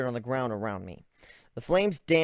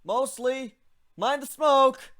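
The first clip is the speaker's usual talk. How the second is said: high character voice; 13 semitones higher, 4 dB louder, much slower than usual. Acted. Protagonist after explosion.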